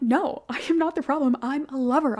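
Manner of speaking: defiant self-defence. Acted. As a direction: protest your innocence with a laugh breaking in. Acted self-defence against being a hater.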